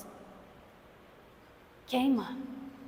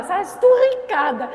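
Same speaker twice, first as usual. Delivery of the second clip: exasperated; 7 semitones higher, 10 dB louder, slower than usual. Real, not acted. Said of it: "exasperated over laundry".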